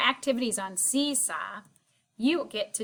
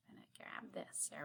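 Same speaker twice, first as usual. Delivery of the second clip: whisper; 7 semitones lower, 21 dB quieter than usual. Real, not acted. Teacher whispers aside while working.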